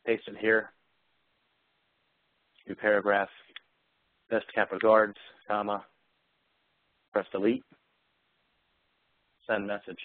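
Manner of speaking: deliberate command-by-command dictation with pauses; showing Outlook dictation to the audience; live dictation. Shown as a demonstration. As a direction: dictate each phrase cleanly to the computer.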